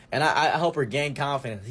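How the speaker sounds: ordinary delivery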